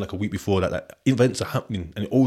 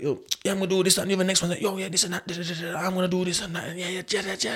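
higher, rambling mock brag; imitating boasting mates; 9 semitones higher, 3 dB quieter, faster than usual. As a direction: lightly mimic the lads hyping themselves up. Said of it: "the man was like".

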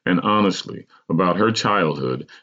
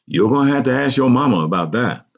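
higher-pitched skeptical aunt; 6 semitones higher, 3 dB louder, faster than usual. A narrator's reading. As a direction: brush the question off doubtfully, pitched up. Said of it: "my aunt responded skeptically".

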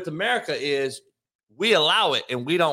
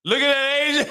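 ordinary talk

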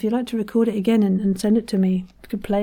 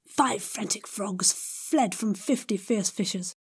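whispering; 9 dB quieter than usual. Shown as a demonstration. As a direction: whisper the frantic frogs. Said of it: "whispered third take".

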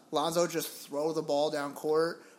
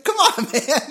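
laughing exasperation; 11 semitones higher, 12 dB louder, slower than usual. Retold or imitated. His own retold exasperated reply.

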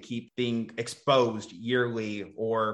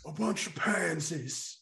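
put-on old man voice; 3 semitones higher, 6 dB quieter, slower than usual. Old man bit again.

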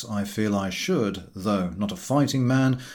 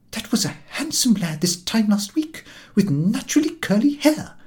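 indignant mother; 12 semitones higher, 3 dB louder than usual. Acted. Mother complains about son.

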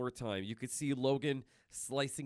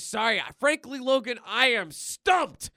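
Deadpool pun; 10 semitones higher, 9 dB louder than usual. Retold or imitated. Deadpool punching Logan with his stump, high loud hammy. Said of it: "he says".

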